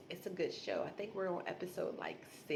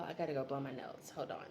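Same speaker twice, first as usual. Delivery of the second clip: ordinary talk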